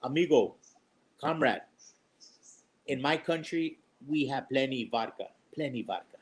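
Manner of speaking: hammed-up deep Russian comrade bragging; Russian accent. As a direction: joke Russian accent, low and proud. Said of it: "He goes".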